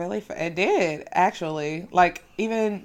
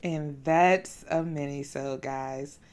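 softer, slower sign-off echo; 6 semitones lower, 8 dB quieter, slower than usual. Real, not acted. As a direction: repeat it softly and slowly. [name] repeats it as a sign-off.

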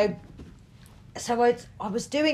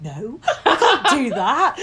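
giggly, high no; laughing refusal; 5 semitones higher, 4 dB louder than usual. Retold or imitated. Refusing to wee in bush.

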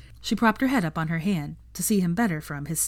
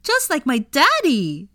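put-on high, loud, slow little boy's delight; happy child; 9 semitones higher, 6 dB louder, slower than usual. A narrator's reading. Teddy happily naming his daddy in a high child's voice. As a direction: beam it out like a proud little boy. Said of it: "said Teddy happily".

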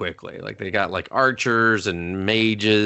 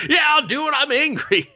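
very high, hammed-up kid voice; fired-up angry kid. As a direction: squeal with mock fury as the kid. Kid eagerly accepts sparring, retold.

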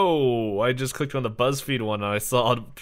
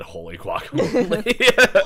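hammed-up exclamation; 10 semitones higher, 10 dB louder, slower than usual. Friends reading silly Batman lines.

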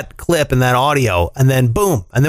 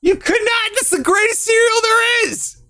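high-pitched outraged yelp. Real, not acted. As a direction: cry out in disbelief, far above usual pitch. Outraged defence of favourite cereal.

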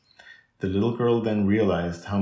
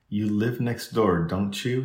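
usual speaking voice

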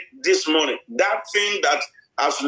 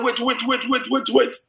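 higher, quick repeated wait; urgent calling out; 7 semitones higher than usual. Retold or imitated. Passenger spotting his stolen car, higher urgent call. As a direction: call the driver to stop.

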